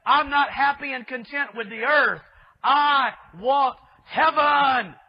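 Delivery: loud, proud declaration, stretched out slowly on I want heaven; Lucifer's defiant demand. Retold or imitated. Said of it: "He was the original one that said".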